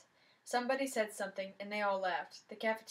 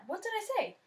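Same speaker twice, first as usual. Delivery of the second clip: high, startled what did I say; a boy caught off guard, pitch jumping up; surprised; 12 semitones higher than usual. Acted. Clueless boy to his jealous friend, surprised and high.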